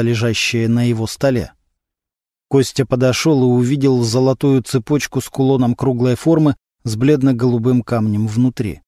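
ordinary delivery

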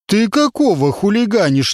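half-played telling-off; a touch of gruff scolding. A narrator's reading. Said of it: "низким голосом стал ругаться женщина-водитель". (водитель слегка ругается)

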